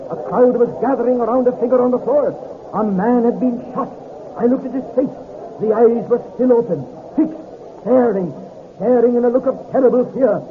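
urgent, rising climax narration; tense narration. A narrator's reading.

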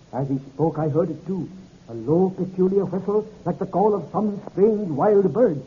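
ordinary talk

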